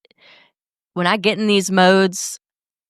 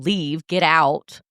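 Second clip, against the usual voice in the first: ordinary delivery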